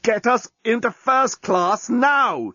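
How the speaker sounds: high, slow, strained demand; choked threat. Acted. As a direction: grind out the order while strangling someone. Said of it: "choked the journalist".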